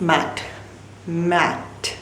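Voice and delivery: mat said slowly as one stretched beat, twice; mat, pause, mat, drawn out on a low level note; word to repeat. Shown as a demonstration. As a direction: model mat for a child, long vowel and clear t. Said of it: "मेरे साथ ये word repeat करो".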